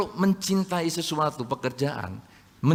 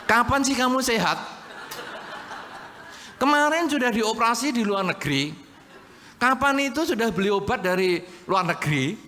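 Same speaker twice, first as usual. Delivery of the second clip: higher, laughing, exasperated complaint; nagging spouse; 6 semitones higher than usual. Retold or imitated. Wife scolding her always-sick husband, mocking and exasperated. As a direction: nag with mocking disbelief.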